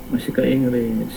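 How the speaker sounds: fading off the mic; drifting away from the mic; voice getting fainter. Real, not acted. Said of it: "suaranya semakin mengecil".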